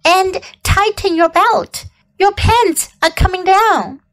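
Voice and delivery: crying out. A narrator's reading. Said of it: "cried Missus Zuckerman".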